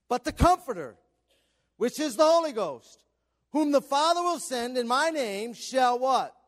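loud, high-pitched preaching cadence; full-force preaching. Acted. Pastor preaching scripture to the congregation, loud and fervent. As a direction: preach it out to the whole room.

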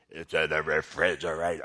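exaggerated, very low monster-like mimicry; wife's changed tone. Preacher mimics wife from the other room, voice dropped low. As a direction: drop the octave way down to mock her. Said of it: "the octave of her tone changed".

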